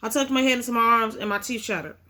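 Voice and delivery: ordinary delivery, nothing marked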